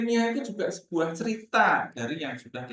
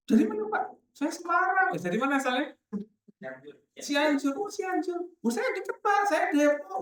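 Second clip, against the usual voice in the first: chatty parent; 11 semitones higher than usual. Retold or imitated. Teacher acts out parents chatting at school.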